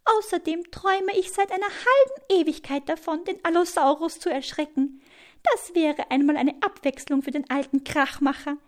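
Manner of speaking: high, tiny bird voice; small bird sharing a secret scheme; confiding hummingbird. A narrator's reading. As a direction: confide a plan in a little high voice. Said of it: "flüstert ihr ins Ohr".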